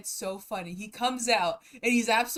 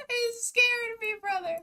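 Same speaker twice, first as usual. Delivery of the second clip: laughing; 12 semitones higher, slower than usual. Real, not acted.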